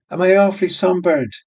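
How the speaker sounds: put-on high, quick young barmaid; light, fast girlish question. Barmaid voiced in reading.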